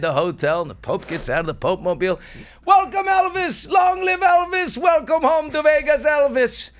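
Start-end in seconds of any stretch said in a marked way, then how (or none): 2.7-6.7 screaming fans. Acted. Crowd cheers the Pope again.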